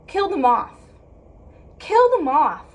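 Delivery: loud, indignant repeat; upset outburst. Real, not acted. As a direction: hit it hard, outraged. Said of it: "I'm so upset".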